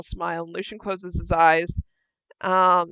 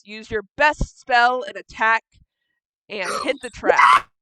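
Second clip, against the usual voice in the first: normal talking voice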